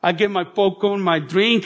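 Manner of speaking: ordinary talk, nothing marked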